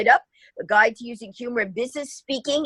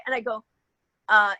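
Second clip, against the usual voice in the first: ordinary talk, nothing marked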